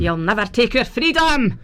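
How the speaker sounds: broad Scottish battle cry; hammed-up Scottish freedom cry; Scottish accent. Shown as a demonstration. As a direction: roll it out in full Scottish. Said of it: "exactly how I sound with my Scottish accent".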